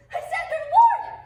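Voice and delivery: loud, high desperate call; frantic call. Acted. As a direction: cry out into the dark. Hermia calling for Lysander.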